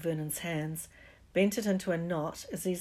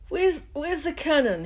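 high, loud, dopey startle; dazed and stupid; 10 semitones higher, 9 dB louder than usual. Acted. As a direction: blurt it out half-awake and dim. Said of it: "he said stupidly".

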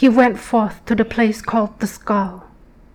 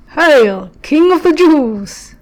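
loud, high, jeering mock salute; mocking hail; 5 semitones higher, 6 dB louder, slower than usual. Soldiers kneeling before Jesus, loud jeering mockery. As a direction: sneer it like a soldier bowing in scorn. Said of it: "they mocked him".

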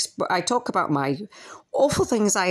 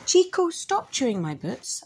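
higher, sharp telling-off; scolding the dog; 7 semitones higher than usual. Real, not acted. Owner scolding Chico for chewing her boots.

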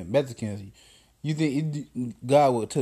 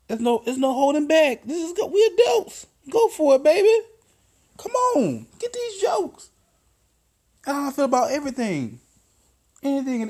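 much higher, squealy, laughing voice; high-pitched cheerleader urging more jokes; friend egging him on; 13 semitones higher, 4 dB louder than usual. Retold or imitated. Voicing the friend's new attitude.

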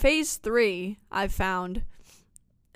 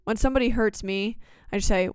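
ordinary delivery

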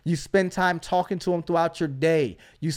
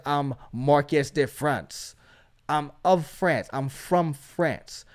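slow, low mock French accent; 5 semitones lower, 6 dB quieter, slower than usual. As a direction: playfully put on a French accent, drawn out. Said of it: "y'all like my French accent".

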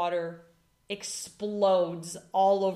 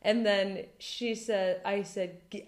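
normal talking voice

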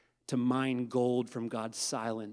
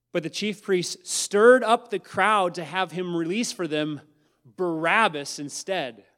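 loud reading; 5 semitones higher, 9 dB louder than usual. Acted. Reading the priests stirring the crowd.

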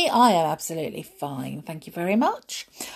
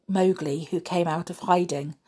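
ordinary delivery

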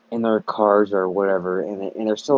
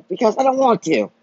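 defiant retort; 9 semitones higher, 4 dB louder than usual. Real, not acted. Retort to mask scolds.

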